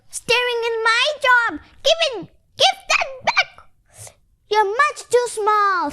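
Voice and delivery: very high, cross cartoon cat voice; squabbling cat voice, slowing on the last words; snapping cat. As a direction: snap at the little duck. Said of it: "snapped the cat".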